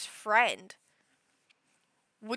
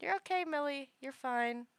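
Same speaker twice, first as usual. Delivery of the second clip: softer, starting higher then settling; soothing; 4 semitones higher, 5 dB quieter than usual. Real, not acted. Streamer calming the cat Millie, soft reassurance. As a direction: gently reassure a pet.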